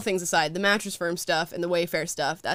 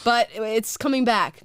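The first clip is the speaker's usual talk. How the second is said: usual speaking voice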